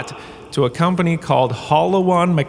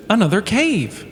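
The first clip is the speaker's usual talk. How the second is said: gasp, then say it much higher in mock horror; dismayed gasp; 9 semitones higher than usual. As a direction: recoil at the sight of yet another cave. Dismayed at finding another cave.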